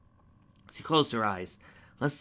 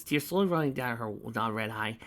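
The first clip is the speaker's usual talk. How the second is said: ordinary talk, nothing marked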